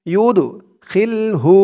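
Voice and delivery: slow, drawn-out recitation of Tilka হুদুদুল্লাহ; recited verse. Shown as a demonstration. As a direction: chant Tilka হুদুদুল্লাহ slowly with long vowels. Recites full verse section. (ধীর সুরে তিলকা হুদুদুল্লাহ তিলাওয়াত)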